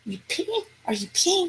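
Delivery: high, soft incredulous questions; hushed disbelief. Retold or imitated. Teller to the man at the fence, hushed disbelief. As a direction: squeak it quietly, as if unable to believe it.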